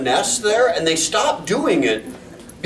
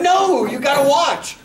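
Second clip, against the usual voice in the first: higher, laughing half-shout; eager insistence; 7 semitones higher than usual. Retold or imitated. Neighbor urging the couple to watch the dog, laughing half-shout. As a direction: insist through a laugh that they must watch.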